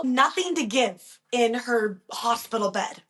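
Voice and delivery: normal talking voice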